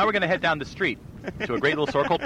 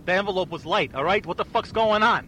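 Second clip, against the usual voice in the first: Tony Soprano; 6 semitones higher than usual. Acted. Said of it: "I'm gonna be Tony Soprano".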